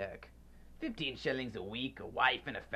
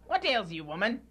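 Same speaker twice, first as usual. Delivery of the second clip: sharp, much higher snap of annoyance; irritated demand; 8 semitones higher, 4 dB louder than usual. Acted. Scrooge snapping at his frightened housekeeper. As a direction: jab the question at her.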